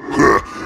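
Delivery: evil laugh. Acted. Villain laughs at opponent.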